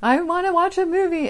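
high, loud whiny wail; child screaming. Retold or imitated. Daughter screaming again at mom, loud high whine. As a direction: wail it like a child denied a treat. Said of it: "then she'd scream again".

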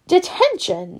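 high, furious teacher shout; roaring. Acted. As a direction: bellow it at the pupil. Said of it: "she roared".

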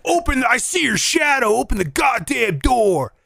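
high-pitched mock shout; angry shouting. Pimp outside the wrong hotel room, imitated angry shout. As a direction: imitate an angry man demanding entry.

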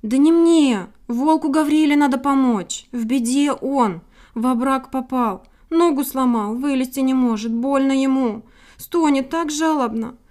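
insistent, worried appeal for help; anxious little titmouse, pleading. A narrator's reading. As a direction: pour out the bad news in distress.

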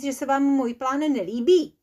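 usual speaking voice

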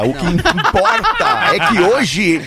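laughing shout; yelling through a laugh. Real, not acted. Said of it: "Não grita!".

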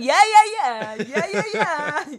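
loud, high laugh-like yeahs; Jimmy Carr. Acted. Co-host mimics an affirming comedian, loud high cackled yeahs. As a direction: turn a comedian's honking laugh into excited yeahs. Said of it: "He's just going".

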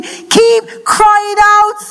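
very slow, each word stretched; drawn-out exhortation. Acted. Preacher urging the church to keep praying, slow and drawn out.

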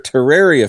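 corrected Terraria, middle rhyming with rare; proper pronunciation. Shown as a demonstration. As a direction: hit the "air" in Terraria. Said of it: "It's properly pronounced Terraria".

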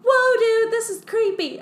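high, loud mock yelp; mock alarm. Fifield startled as the snake wraps the arm, high loud yelp.

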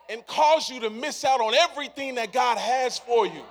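loud, driving exhortation; preaching climax. Acted. Preacher warning the church to guard their hearts, shouting. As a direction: push it out to the back row.

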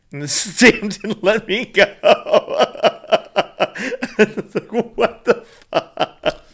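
high, helpless laughter through the words; laughing. Real, not acted. Traveller telling friend about a stamp, laughing. Cracking up while telling story.

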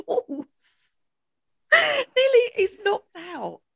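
laughing high through the words; real laughter breaking in; nearly crying with laughter. Real, not acted. Laughing recalling lamp fall.

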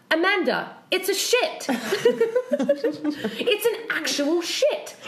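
high, incredulous squeal through laughter; imitating a friend's appalled cry, drawn out; shocked exclamation. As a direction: retell the horrified discovery in a high voice, cracking up. Acting out friend's shocked discovery.

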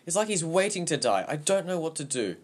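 high, loud, desperate outburst; distraught boy near tears; tearful. A narrator's reading. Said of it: "There were tears in my eyes".

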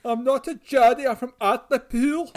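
put-on high, loud North East English accent; Geordie accent. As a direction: protest in a hammed-up Geordie accent. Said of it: "the Geordie accent in the U K".

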